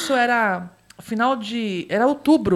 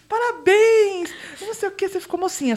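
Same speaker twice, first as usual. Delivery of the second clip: weepy mother voice; 7 semitones higher than usual. Retold or imitated. Mother cooing congratulations at the bathroom door. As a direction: mimic a sentimental mom. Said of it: "E ela falando assim".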